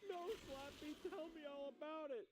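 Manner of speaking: high mocking sarcasm; high, hammed-up sarcastic reply; sarcastic kid voice. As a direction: roll your eyes as the kid.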